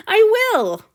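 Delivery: high loud cry; bold declaration. A narrator's reading. Hanyu ready to fight Antony, a high bold cry. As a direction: fling it out defiantly.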